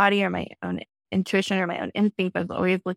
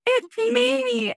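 retold excited scream; much slower than usual. To friend Rebecca on the beach, high excited mock scream. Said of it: "I just started screaming at her".

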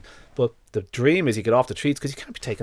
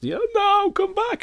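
high sing-song call across a park; hollering after a dog that won't come; 3 dB louder, slower than usual. Retold or imitated. Owner calling a runaway dog back, high sing-song.

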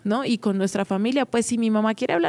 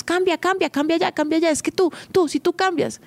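much higher, insistent nagging at the husband; nagging wife; 7 semitones higher than usual. Retold or imitated. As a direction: keep pressing him to change, chanting it. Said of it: "Nosotros decimos". (esposa que regaña, mucho más aguda e insistente)

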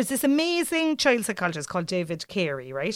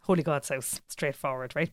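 ordinary delivery

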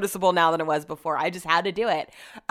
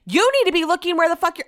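high, loud scolding; imitated furious yell; retold screaming; 11 semitones higher, 5 dB louder, faster than usual. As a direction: re-enact bawling out a driver. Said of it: "but I was screaming".

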